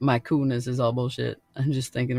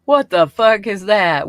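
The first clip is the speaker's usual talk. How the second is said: slow, louder, disgusted "what the fuck"; appalled outburst; 5 semitones higher, 6 dB louder, slower than usual. Retold or imitated. Reacting to the weird blush line, slow and appalled. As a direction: recoil at the sight, drawn out. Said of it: "I'm like".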